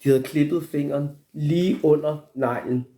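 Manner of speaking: usual speaking voice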